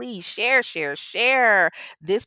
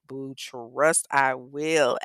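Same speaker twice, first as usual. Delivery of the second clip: ordinary talk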